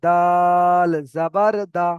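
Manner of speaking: long, loud, level "দাল", then "জাবার দা"; spelling demonstration. Shown as a demonstration. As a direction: stretch "দাল" like a slow recitation. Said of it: "তাহলে এখানে হবে".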